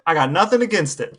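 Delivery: ordinary talk, nothing marked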